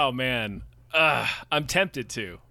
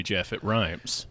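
deep mock solemn; 11 semitones lower, 8 dB quieter, slower than usual. Quoting Star Wars line.

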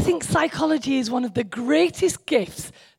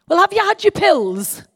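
brisk, louder husband asking about pills; husband's question; 9 semitones higher, 5 dB louder than usual. Retold or imitated. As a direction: play the practical husband, loud and higher. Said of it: "And Anne says to me, he says".

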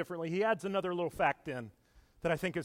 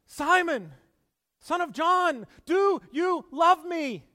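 much higher and louder, do you love me drawn out slowly; Jesus' third asking, high, loud and slow; pleading Jesus; 11 semitones higher, 9 dB louder, slower than usual. Acted. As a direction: cry it out, slow and aching. Jesus asks the third time.

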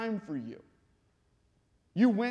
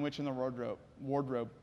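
ordinary talk

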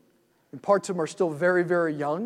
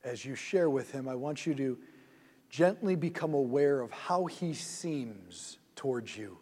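soft, slow meditation voice, lower and quieter; guided meditation; 3 semitones lower, 3 dB quieter than usual. Acted. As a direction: lead them gently into the scene, unhurried.

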